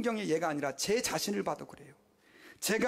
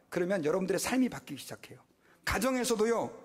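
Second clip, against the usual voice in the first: ordinary delivery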